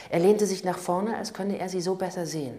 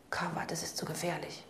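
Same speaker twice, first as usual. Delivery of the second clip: hushed warning, softer; urgent whisper; 7 dB quieter than usual. A narrator's reading. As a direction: whisper it so no one hears. Regina warnt leise im Auto. (eindringlich geflüstert)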